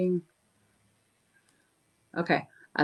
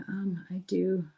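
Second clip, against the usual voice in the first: whisper; 3 semitones higher, 6 dB quieter, slower than usual. Real, not acted. Whispered aside.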